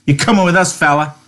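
high, loud, suspicious challenge. Acted. Curly challenges George, suspicious and loud. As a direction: eye him with distrust. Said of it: "He turned suspiciously on George".

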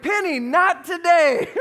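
high, loud, slow parental shout; retold fatherly yell, stretched and forceful; imitated alarmed dad. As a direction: shout to stop an overeager child. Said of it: "I'm like".

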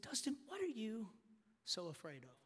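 soft, hushed divine question; Holy Spirit speaking. Retold or imitated. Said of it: "I felt the Holy Spirit speak to me so clearly".

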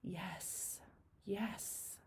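soft, breathy repeated yes; hushed, moved yes; quiet relief. Retold or imitated. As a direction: let each yes drop to almost nothing. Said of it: "I just felt like".

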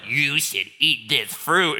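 serpent voice. Retold or imitated. Serpent tempting Eve in the garden, slow low voice. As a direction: draw it out low like a coaxing serpent. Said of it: "a serpent literally slithers up".

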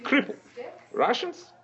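put-on high, heavy Russian accent. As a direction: boast like a gruff Russian. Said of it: "your Russian accent".

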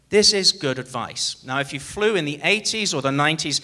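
usual speaking voice

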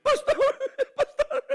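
high mock-whiny parent calling the pastor; whiny worried parent. Pastor imitates a worried father begging for help, high and whiny. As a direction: squeak it out like a fretting father.

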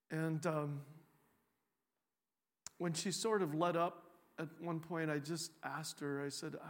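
usual speaking voice

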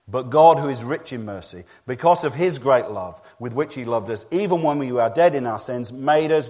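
loud, emphatic scripture reading; forceful preaching. Real, not acted. Preacher reads Ephesians to the congregation, forceful proclamation.

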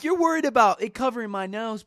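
loud, high mock outrage; indignant retort. Imagined indignant retort to maskless woman.

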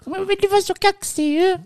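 very high-pitched imitated complaint; mock angry woman. Imitating the woman he teased, high and indignant. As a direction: put on a high, upset voice. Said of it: "Varför blir du så arg".

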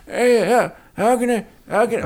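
put-on high, thin old man voice; creaky old man impression. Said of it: "Just did an old man".

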